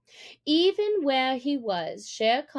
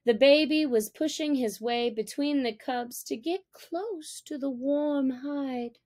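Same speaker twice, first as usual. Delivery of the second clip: usual speaking voice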